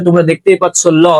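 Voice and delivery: usual speaking voice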